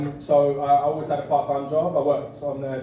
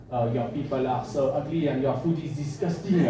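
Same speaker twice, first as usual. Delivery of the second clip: put-on French accent for a joke; comic French-accented English. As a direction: imitate a French person speaking English. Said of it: "French people can say anything".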